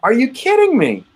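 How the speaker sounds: high, incredulous outburst; stunned, can't believe it; disbelief. Real, not acted. Shocked at signature placement.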